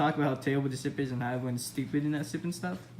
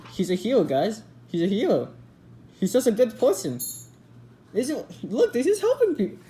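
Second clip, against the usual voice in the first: loud, high, hammed-up boastful hero voice; mocking Soldier as hero; 6 semitones higher, 6 dB louder than usual. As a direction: brag it like a smug fake hero, climbing higher. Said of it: "Look, he's like".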